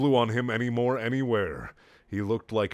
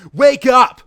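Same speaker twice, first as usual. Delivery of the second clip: loud high yell; shout; 14 semitones higher, 17 dB louder than usual. Acted. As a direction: bellow it as hard as you can. Sonic yells at Amy again.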